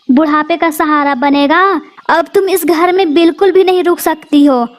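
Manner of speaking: put-on cold stepmother throwing the girl out; a mean stepmother, starting fast; harsh stepmother. Stepmother throws daughter out, harsh and cold.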